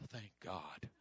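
muttered relief under the breath; quiet private relief; relieved aside. Retold or imitated.

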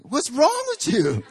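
high, loud, drawn-out exclamation; mock outrage. Onlookers to the phone dropper, high mock-outraged yell. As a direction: imitate a scandalized crowd, stretched and squealed. Crowd reacts to the man.

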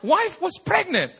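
very high, slow imitated Chinese accent; mock Chinese-accented cross-examination; Chinese-accented pastor. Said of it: "I look at the Chinese pastor".

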